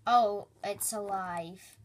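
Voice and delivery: normal talking voice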